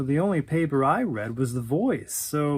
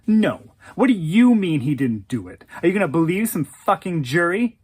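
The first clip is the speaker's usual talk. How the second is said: louder, indignant confrontation; angry challenge; 6 dB louder than usual. Acted. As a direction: demand an answer, outraged. She confronts him in a quarrel.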